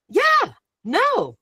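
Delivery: high-pitched, falling dismissal; emphatic refusal. Retold or imitated. Speaker on getting around all these people, high dismissal. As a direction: swoop high, then drop on each word. Rejects the crowd's effect on her.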